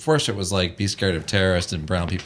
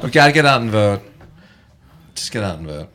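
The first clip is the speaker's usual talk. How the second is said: usual speaking voice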